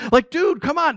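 high, pleading dude; exasperated. Real, not acted. Preacher to congregation, high exasperated plea.

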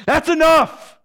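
loud, high cut-off; firm rebuke. Acted. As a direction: shut the argument down. Son snaps back at father.

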